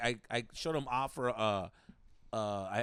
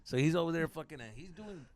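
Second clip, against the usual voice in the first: normal talking voice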